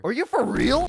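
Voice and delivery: high, loud burst of disbelief; incredulous. Acted. Barista hears writer will write about the shop, incredulous. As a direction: blurt it out, voice jumping way up. Shocked at the writing plan.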